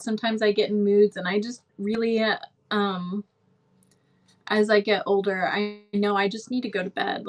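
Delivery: ordinary delivery, nothing marked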